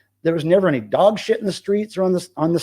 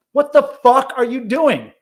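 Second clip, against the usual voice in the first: retold yell; 9 semitones higher, 6 dB louder than usual. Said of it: "said "fuck" ... really loud".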